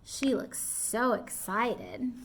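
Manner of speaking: usual speaking voice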